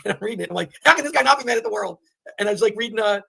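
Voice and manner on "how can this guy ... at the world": high, loud retold disbelief; incredulous. Reader reacting to a Holocaust survivor's book, incredulous. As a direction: protest in raised-pitch amazement.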